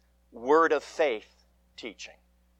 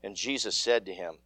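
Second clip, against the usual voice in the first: measured, solemn scripture reading; gospel reading; 4 semitones lower than usual. Acted. Priest reading the gospel to the congregation, solemn and measured. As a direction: read the gospel aloud to the congregation.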